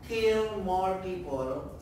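ordinary talk, nothing marked

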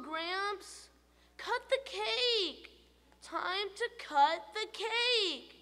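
a greedy tooth nagging for cake; the tooth's voice. A narrator's reading.

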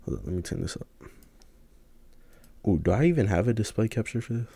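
ordinary talk, nothing marked

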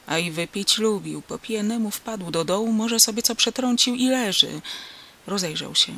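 ordinary delivery, nothing marked

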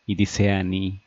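usual speaking voice

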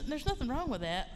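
comic disclaimer. Acted. Seinfeld-style disclaimer, noticed afterwards.

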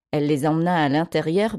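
ordinary delivery, nothing marked

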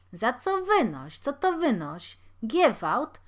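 high, loud indignant shouting; outraged, yell back; angry yelling. Acted. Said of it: "wrzeszczał rozgniewany Żydek".

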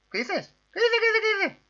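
very high rapid-fire squeak; startled squeaking. Pretend. Gamer startled in the match, high squeaky repeats.

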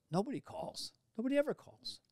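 high, put-on old actor's grumble; mock complaint in a high old man's voice; Harold Gould impression. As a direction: whine it like a lonely old actor. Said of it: "And he goes".

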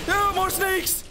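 high-pitched outraged protest; indignant shout. Real, not acted. Player protesting unfair snakes, indignant shout.

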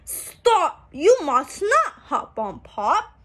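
bright, sing-song picture-book reading; reading to children. A narrator's reading. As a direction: read it out like a storybook for little kids. Book text read aloud.

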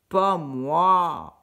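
refus grogné, plus fort; cochon grognon. A narrator's reading. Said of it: "grogna le cochon". (le cochon refuse encore, grognement)

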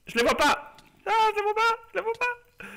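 panicking. Real, not acted. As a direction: cry out in growing panic. Can't see the silverfish, panicking.

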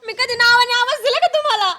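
high-pitched indignant question; indignant protest. Acted. Wife defending herself to husband, indignant.

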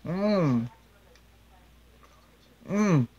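humming while chewing. Real, not acted. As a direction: mouth full of bacon. Seen 0: Bacon bitten beforehand; lips closed, jaw working while humming "mm.

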